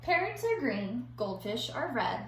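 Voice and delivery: put-on lively storybook reading for kids; reading to children. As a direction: read it like a picture book to young pupils. Teacher reads picture book aloud.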